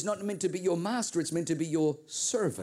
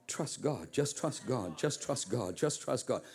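lower, softer repeated reassurance; quiet refrain; 5 semitones lower, 4 dB quieter, slower than usual. Real, not acted. Preacher reminding the couple to trust God, soft and calm. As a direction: say it gently, like a mantra.

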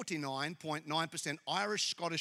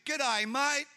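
higher, louder put-on Aussie accent; mock Australian accent; 7 semitones higher, 5 dB louder than usual. People walk up to the preacher attempting Australian, mock Australian accent. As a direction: do an overdone Australian impression. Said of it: "trying to do an Australian".

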